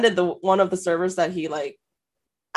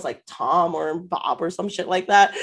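usual speaking voice

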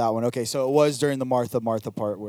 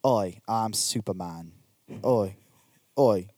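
Cockney Superman; 4 semitones lower, 4 dB quieter, slower than usual. Acted. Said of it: "I'll be Superman".

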